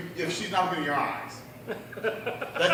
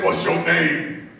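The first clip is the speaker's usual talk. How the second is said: slow, loud, drawn-out demand; big cellmate; 6 dB louder, much slower than usual. Retold or imitated. Big cellmate questions the new girl in jail, slow and imposing. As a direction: size up a new inmate, heavy and slow. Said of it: "my cellmate was this big girl".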